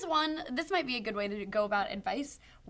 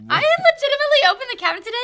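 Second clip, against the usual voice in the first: excited; 13 semitones higher, 11 dB louder, faster than usual. Real, not acted. Excited burst.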